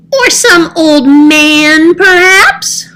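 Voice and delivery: high and slow, slyly teasing; a cheeky cat hinting at the old man. Acted.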